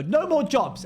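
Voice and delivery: end-of-day cheer; mock shout. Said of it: "then you go".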